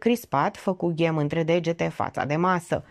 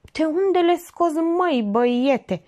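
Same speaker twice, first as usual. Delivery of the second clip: marvelling; 11 semitones higher than usual. A narrator's reading. Georgeta marvels at the young man's information, awestruck. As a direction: gush in amazed admiration, slowing at the end. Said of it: "se minună Georgeta, zdrobită de admirație".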